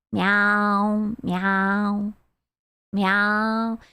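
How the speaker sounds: low, lazy cat meows; deadpan meowing. Pretend. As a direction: say meow low and drawn, like a bored cat. Meowing during the scrub.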